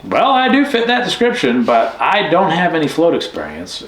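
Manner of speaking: high, eager retold answer; imitated eager reply. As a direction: pitch it up, keen but hesitant. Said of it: "I'm like".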